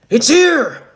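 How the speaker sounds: high, full-volume warning shout. A narrator's reading. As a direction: shout the alarm, high and loud. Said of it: "to which Joseph shouts".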